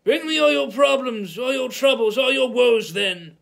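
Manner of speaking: screamed. A narrator's reading. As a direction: scream it, at the end of his rope. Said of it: "he screamed".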